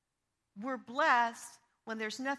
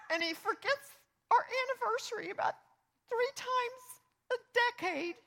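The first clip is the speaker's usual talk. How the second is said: much higher, whiny wife voice; mock complaining wife; 12 semitones higher, 5 dB louder than usual. Pastor voices a wife listing her husband's faults, mock whiny. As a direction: play the fed-up wife listing grievances. Mocking a wife's complaint list.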